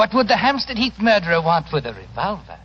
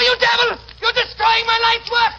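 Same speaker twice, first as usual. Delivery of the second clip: high, shouted raging cry; furious despairing shout; anguished fury. Acted. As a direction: rage at the man ruining you. Villain watches his museum burn.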